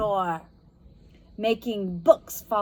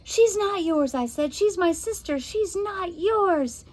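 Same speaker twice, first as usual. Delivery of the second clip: put-on higher, firm girl voice; girl's defiant voice; 10 semitones higher than usual. A narrator's reading. Josie defies the witch over her sister. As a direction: stand up to the witch.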